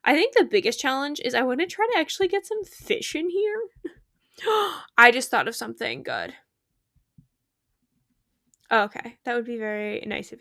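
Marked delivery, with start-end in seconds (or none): 4.4-4.9 sharp, high intake of breath; sudden gasp. Real, not acted. Streamer struck by a good idea, excited gasp.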